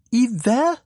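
shrieked up high and loud; a boy yelping in disbelief; loud high astonished yelp; astonished shout. A narrator's reading. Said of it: "råbte Frode".

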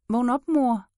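lifted, pleading voice; waking mother. Acted. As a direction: urge your sleeping mother to wake. Child tries waking mother.

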